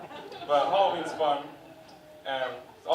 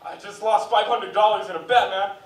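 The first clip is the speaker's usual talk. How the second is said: loud, higher mock despair; devastated gambler; 7 semitones higher, 9 dB louder than usual. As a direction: whine about losing the bet. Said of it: "people who watch fighting games competitively".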